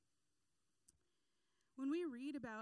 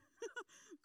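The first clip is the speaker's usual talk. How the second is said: high giggle; 11 semitones higher than usual. Real, not acted. Laughing at own story.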